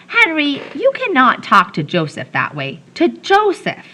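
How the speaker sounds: high, indignant scolding; almost cross. A narrator's reading. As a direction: scold him for his rudeness. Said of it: "almost cross".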